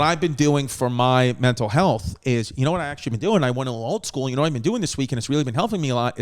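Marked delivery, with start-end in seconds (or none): none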